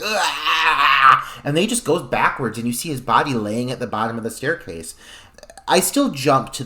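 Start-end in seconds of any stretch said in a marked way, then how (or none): 0.0-1.2 short loud grunt of a man hit by an axe; mock death grunt. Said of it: "he's like".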